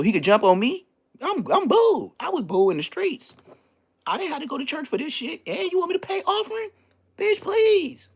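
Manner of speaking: high indignant comic rant; incredulous rant. Real, not acted. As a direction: scoff at paying offering, voice climbing. Mocking going to church.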